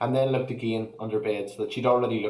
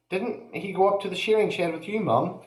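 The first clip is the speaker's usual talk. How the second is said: nervous question; 9 semitones higher than usual. Acted. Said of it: "suddenly nervous".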